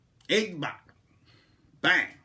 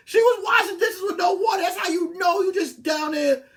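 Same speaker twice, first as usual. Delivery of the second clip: higher, louder, shouting through laughter; laughing shout; 13 semitones higher, 6 dB louder than usual. Real, not acted. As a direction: yell it while cracking up. Laughing at his own story.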